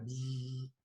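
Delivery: soft, low imitated buzzing; quiet drawn-out bzzz; fly buzz. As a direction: hum a small insect buzz.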